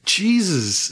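retold jolt of surprise; retold amazement. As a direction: exclaim it as if taken aback. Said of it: "I always get surprised".

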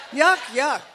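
a loud disgusted yuck, twice. Shown as a demonstration. Preacher leading the crowd, loud and disgusted. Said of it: "Say yuck twice".

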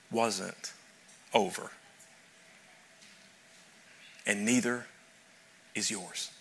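hushed pastoral comfort; soft reassurance. Real, not acted. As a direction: lay it down gently for them. Sermon, tender assurance.

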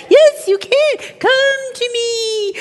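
high, drawn-out siren call; luring tempting thing. Acted. The thing beckons the person closer, high and coaxing. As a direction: lure them in, hammed up. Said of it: "this thing over there that says".

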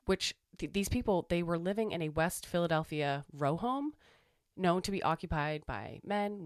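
measured documentary-style narration; deliberate scripted delivery with spaced pauses; reading aloud. Acted. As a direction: read a grave historical account in even, careful phrases. Reads out a historical account.

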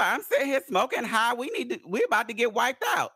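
high-pitched imitation of stoned panic; mock panic. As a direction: ham up a frightened friend who wants to pray. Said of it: "She's like".

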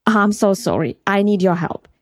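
ordinary delivery, nothing marked

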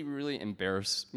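normal talking voice

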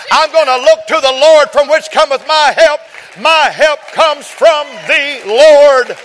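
loud, high, ringing preacher's cry; climbing sermon shout; full-force preaching. Real, not acted.

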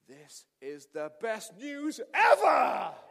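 slow, loud, exultant cry; joyful shout. Retold or imitated. Pastor shouts with joy at the tearful student's news. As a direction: throw it out with delight.

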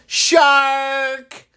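high, drawn-out shout; imitated yell of alarm; mock yell. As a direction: holler it out in imitation of a prankster. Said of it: "from the balcony yells".

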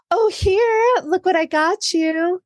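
playing the proud generous friend showing off a gift; eager gift giver. To someone receiving her gift, an eager giver's voice.

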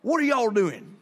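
imitating dad's country accent; a light country twang, questioning the kids. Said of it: "My dad's kinda country".

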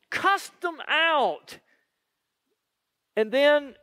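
ordinary delivery

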